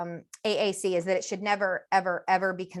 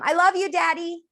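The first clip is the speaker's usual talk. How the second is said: put-on high, louder little child saying it; toddler voice; 9 semitones higher, 5 dB louder than usual. Toddler's first phrase to dad, high child voice. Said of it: "they say".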